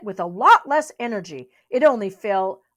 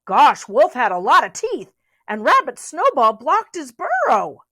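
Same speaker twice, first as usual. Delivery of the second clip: ordinary delivery, nothing marked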